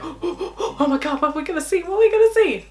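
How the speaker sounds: giddy squeal that climbs to the end; excited rush. Acted. Researcher thrilled by the rush of data analysis, excited. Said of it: "The rush of that is like".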